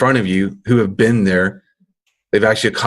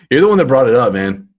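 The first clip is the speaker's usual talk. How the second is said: usual speaking voice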